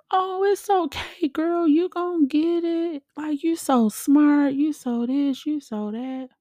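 very high, drawn-out cooing, mocking her own comforting; gushing reassurance. To a crying friend in the passenger seat, gushing reassurance. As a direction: coo it way up high, hammed-up sympathy.

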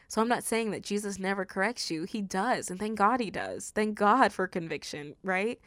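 usual speaking voice

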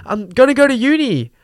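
hammed-up eager student; mock naive student. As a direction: parody an eager kid dreaming of uni. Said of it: "feeding into this narrative of".

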